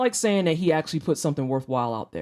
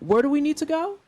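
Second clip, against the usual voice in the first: repeating the dad's line higher, the question rising but meant as a command; demand with a question mark; 7 semitones higher than usual. Shown as a demonstration. Showing chat the dad's demand, mock polite order. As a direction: make the question sound like an order you will not repeat. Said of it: "note the inflection on that".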